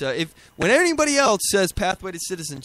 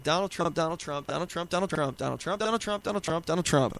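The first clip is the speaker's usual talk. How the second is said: soft, droning rattle of the same name; monotonous chant, worn down by it; weary pounding repetition; 2 semitones lower, 6 dB quieter than usual. Retold or imitated. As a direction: repeat it over and over like a tiresome refrain. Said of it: "getting beaten over the head with".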